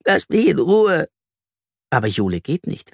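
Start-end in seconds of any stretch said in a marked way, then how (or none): 0.0-1.1 sobbing. A narrator's reading. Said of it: "schluchzt Flori".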